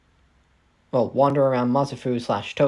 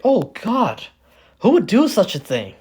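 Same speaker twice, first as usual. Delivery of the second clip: high, louder outburst of disbelief; mock shock; 8 semitones higher, 6 dB louder than usual. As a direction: exclaim as a character appalled by the news. Acted shock at burned arms.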